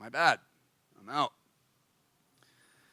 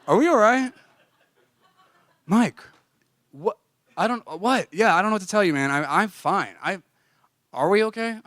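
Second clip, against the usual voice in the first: a hint of a needy girlfriend, pitched up and louder; 6 semitones higher, 6 dB louder than usual. To his roommate, asking again if they're okay, needy and pitched up. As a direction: plead like a clingy partner. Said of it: "feel like a really needy, like, girlfriend".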